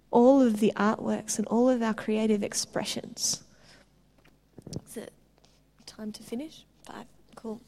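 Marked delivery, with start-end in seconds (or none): 4.9-7.6 whisper. Real, not acted. Asking the host quietly.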